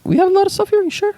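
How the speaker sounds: high squeaky falsetto; mock his line in falsetto; mocking falsetto echo.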